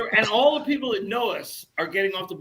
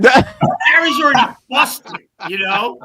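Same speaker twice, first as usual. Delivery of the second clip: mock indignant; 8 semitones higher, 9 dB louder than usual. Mock protest, high loud.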